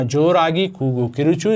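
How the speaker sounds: ordinary delivery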